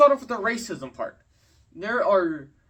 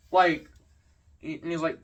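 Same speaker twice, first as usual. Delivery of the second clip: ordinary delivery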